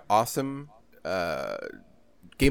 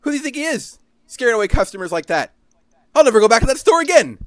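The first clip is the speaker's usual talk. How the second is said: high, loud indignant rant; 9 semitones higher, 5 dB louder than usual. Real, not acted. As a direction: vent about the store, fired up. Ranting indignantly about the store.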